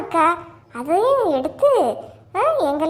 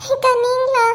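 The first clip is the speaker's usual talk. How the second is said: high tearful greeting through sobs; put-on sobbing as you speak; crying; 7 semitones higher, 3 dB louder than usual. Weeping Gita notices a visiting neighbour, crying. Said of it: "இருந்து அழுதுகிட்டு இருக்கா".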